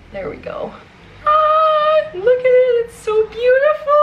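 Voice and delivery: near-sobbing delight at her new book; tearful joy. Real, not acted. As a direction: overcome with happy tears, voice cracking high. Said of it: "I feel like I'm gonna friggin' cry".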